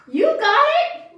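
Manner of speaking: high, loud cheering; excited cheer. Real, not acted. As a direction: cheer them on. Cheering child on.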